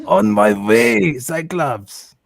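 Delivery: put-on lightly done German accent; hint of a German accent for Nightcrawler. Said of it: "I have to do a German accent".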